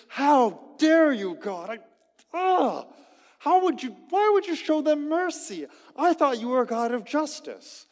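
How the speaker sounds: loud, high indignant outburst at God; angry Jonah. Retold or imitated. Preacher voicing Jonah shouting at God. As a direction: rage at God for showing mercy. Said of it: "Jonah is angry. Uh, and he says".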